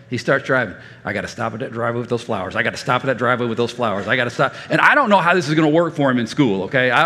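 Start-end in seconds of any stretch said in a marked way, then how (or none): 1.0-4.5 rapid self-talk. Retold or imitated. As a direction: imitate a kid's fast, low chant. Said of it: "Self-talk is great".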